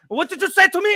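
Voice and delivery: high, loud indignant outburst; mock angry challenge. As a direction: explode in imitated fatherly outrage. Imitates father exploding at son.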